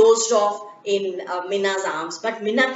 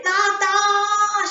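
high, ringing call to her father; girl calling to her father in the forest; 10 semitones higher than usual. Acted. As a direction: call back with relief. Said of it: "she called out".

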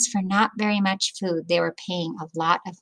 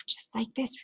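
whisper; 4 semitones higher, 5 dB quieter than usual. Real, not acted. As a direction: lean in and whisper it. Aside to listening children.

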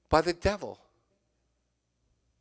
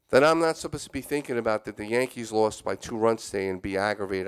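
ordinary talk

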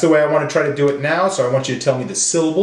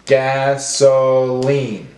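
gas... o... line, slowly; broken into syllables; much slower than usual. Shown as a demonstration. Teacher breaking up words for class, slow and segmented. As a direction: sound it out syllable by syllable. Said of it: "break up our words into syllables".

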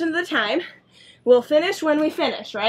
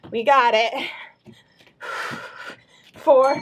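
normal talking voice

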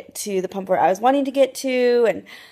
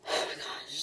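breathless worried whisper; 7 semitones lower, 19 dB quieter than usual. Real, not acted. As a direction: gasp it under your breath. Keys maybe locked in car.

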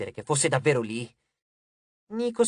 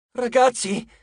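loud, high-pitched alert; alarmed call; 8 semitones higher, 5 dB louder, slower than usual. A narrator's reading. Hazel spotting something on the horizon, alarmed call. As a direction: call out sharply to the group.

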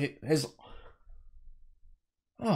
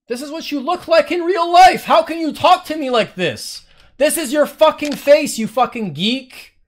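much higher and louder, yelling at the screen; furious shout; 10 semitones higher, 10 dB louder than usual. Real, not acted. Streamer yelling at an online troll, a furious shout. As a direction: tear into him at full volume.

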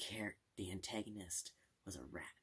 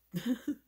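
loud laugh; 15 dB louder than usual. Real, not acted. Sudden laugh.

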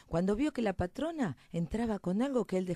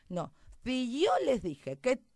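raised, loud rustic mouse voice; reproachful little mouse; 6 semitones higher, 7 dB louder than usual. Acted. Mouse laments that nobody would listen, aggrieved. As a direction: protest it, leaning hard on the key words. Mouse says told you so.

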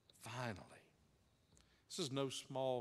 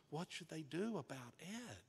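softer, hushed congregation murmur, still voiced; mild mock whisper; 5 dB quieter than usual. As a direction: voice their worried whisper lightly. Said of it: "lean over toward each other and whisper".

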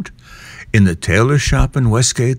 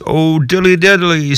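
sigh; 6 semitones higher than usual. Acted. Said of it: "sighed Simpkin".

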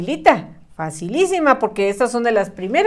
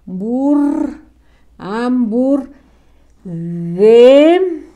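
slow separated syllables for spelling; syllable dictation; 3 semitones higher, much slower than usual. Shown as a demonstration. Teacher dictating to pupils, syllables pulled apart. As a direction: break it into slow chunks for the class. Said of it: "Ahora la del susto".

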